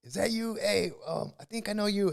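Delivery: high, excited fan voice. Retold or imitated. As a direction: play a starstruck stranger. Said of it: "people would come up".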